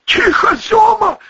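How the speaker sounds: high, forceful hiss; hissed hushing. A narrator's reading. Second figure silencing his pal, hissing. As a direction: hiss it as an urgent order. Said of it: "зашипела вторая фигура".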